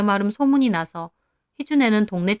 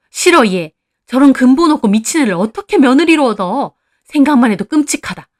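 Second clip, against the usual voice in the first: loud, raised-pitch scorn; disgusted refusal; 5 semitones higher, 9 dB louder than usual. A narrator's reading. Mother-in-law rejects Heeju as a daughter-in-law, appalled and scornful. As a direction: recoil from the very idea, loudly.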